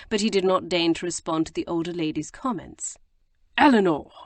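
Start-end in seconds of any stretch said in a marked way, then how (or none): 3.6-4.1 loud angry call of her name; growled. A narrator's reading. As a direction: bark her name in anger. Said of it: "he growled".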